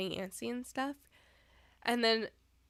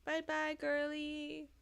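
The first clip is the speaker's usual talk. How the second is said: pet talk; 6 semitones higher, slower than usual. Real, not acted. Saying bye to cat.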